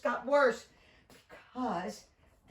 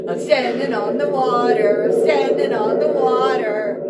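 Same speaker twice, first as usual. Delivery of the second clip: amazed delight; 12 dB louder than usual. Acted. Peter amazed on water.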